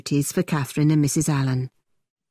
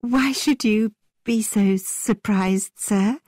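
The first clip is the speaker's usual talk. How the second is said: higher, slow, theatrical lady; hammy stage actor; 5 semitones higher, slower than usual. A narrator's reading. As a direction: play it like a stage actress. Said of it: "was now speaking like an actor in the theatre".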